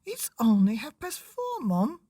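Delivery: put-on high young boy's voice; high child pleading with mum. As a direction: pipe up like a child protesting bedtime. Child Lester protests to mum.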